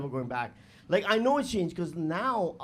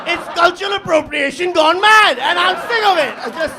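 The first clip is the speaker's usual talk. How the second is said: louder, higher mock-indignant rant; mock outrage; 9 semitones higher, 12 dB louder than usual. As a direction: ham up the fake grievance.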